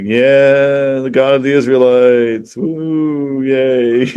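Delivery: drawn-out, half-hearted mock cheer; lackluster sermon. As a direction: drag out each word with feigned, unenthusiastic praise. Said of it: "gives, like, the most lackluster sermon ever".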